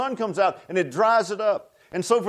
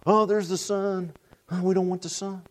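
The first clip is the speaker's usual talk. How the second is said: mock whining plants. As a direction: whine like a wilting plant. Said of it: "the plants are out there saying".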